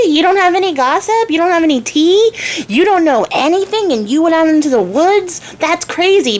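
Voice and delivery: high, excited gossip tease; can't believe they have no tea; gossipy disbelief. Retold or imitated. Benjamin teases about gossip.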